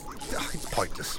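whispered under breath; muttered aside. Acted. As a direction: mutter it to yourself.

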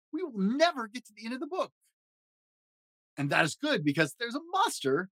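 high fuzzy Muppet monster, jittery and fretful; Grover voice. Acted. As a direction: play a nervous, lovable blue monster. Grover voice reading story.

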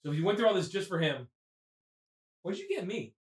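ordinary talk, nothing marked

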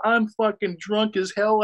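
put-on a touch of drunken bravado; mock drunk boast. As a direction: play the tipsy host protesting. Drunk self retold.

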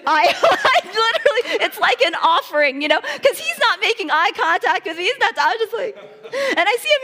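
high, loud laughter with a catch of tears; laughing through tears. Real, not acted. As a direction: laugh as the emotion spills over. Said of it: "laughing and tearful retelling".

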